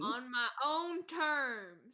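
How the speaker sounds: drawn-out, stubborn declaration; insistent declaration. Acted. Sleeper answering talk of his own terms, drawn-out and insistent. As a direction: stretch out every word stubbornly. Defiant echo of the game master.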